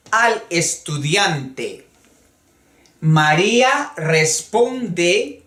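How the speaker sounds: frases leídas despacio y claras para repetir; léelo como modelo para la clase; lectura didáctica lenta. Shown as a demonstration. Lee frases de lección despacio. (lectura lenta de profesor)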